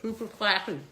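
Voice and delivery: talking through a mouthful; mumbled around a bite of food; mouth full. Real, not acted. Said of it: "bad manner eating".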